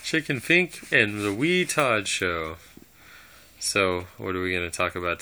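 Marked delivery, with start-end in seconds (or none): 0.0-2.6 slow, drawn out show title; announcer voice. Acted. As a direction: stretch it out like a host. Podcast intro announcement.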